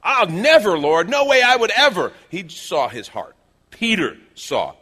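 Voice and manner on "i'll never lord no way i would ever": loud, high-pitched protest; vehement denial. Acted. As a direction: swear it at full voice as Peter. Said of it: "'cause he was in denial".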